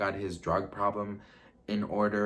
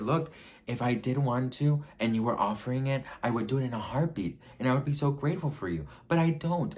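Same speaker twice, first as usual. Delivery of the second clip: rising higher and faster into a heated no; heated retold refusal; 6 semitones higher, 5 dB louder than usual. To the man on the phone, refusing to transition, rising heated and fast. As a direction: build up fast to a loud, high "But I don't". Telling him she won't transition.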